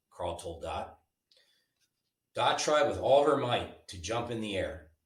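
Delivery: ordinary delivery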